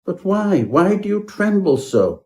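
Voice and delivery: high, incredulous questions; perplexed gentleman pressing for answers; baffled questioning. A narrator's reading. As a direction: pitch it up in bewilderment. Puzzled host questions trembling guest.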